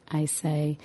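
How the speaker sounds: ordinary delivery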